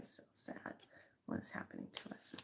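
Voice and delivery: a hushed fake-tragic aside; mock sad whisper. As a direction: sigh it out like a playful mourner. Mock lament after weekend joke.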